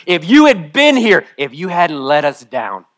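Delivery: high, loud accusing cry; anguished outburst at the one who let her down; ugly, tearful agony. Acted. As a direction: wail it out in raw grief. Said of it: "There's tears and there's snot. It's ugly".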